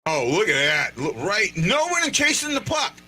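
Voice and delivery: ordinary talk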